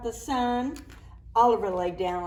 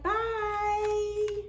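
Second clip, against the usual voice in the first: bright high farewell to the sun; lilting high goodbye; high sing-song bye; 10 semitones higher than usual. Acted. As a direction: sing it up high like waving to a toddler.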